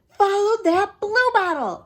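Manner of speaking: shouting to his friend at the door. Acted. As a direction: yell it as you run. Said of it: "I shouted".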